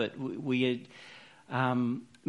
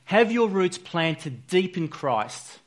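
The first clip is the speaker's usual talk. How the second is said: slow, raised, weighty reading voice; scripture reading; 5 semitones higher, 5 dB louder, slower than usual. Acted. Preacher reads Colossians to the congregation, slow and raised. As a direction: read the verse out to the church, slowly.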